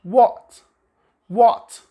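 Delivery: what with a rounded British O vowel. Shown as a demonstration. Teacher shows the British what. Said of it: "in this word".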